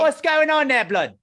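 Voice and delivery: high, loud hammed-up Cockney; mock Cockney. Asking in mock Cockney slang. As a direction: greet him like a London lad.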